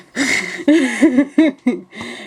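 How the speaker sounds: high burst of laughter; laughing. Real, not acted. Laugh after reaction.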